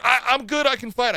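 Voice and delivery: high-pitched plea to keep fighting; retold protest. Retold fighter pleading to continue.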